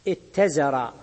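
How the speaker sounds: اتزر as ittazara, with doubled t; irregular merged ta. Shown as a demonstration. Teacher cites the exceptional saying, اتزر with doubled ta. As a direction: hold the doubled ta in اتزر after a short i. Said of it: "بإبدال الياء".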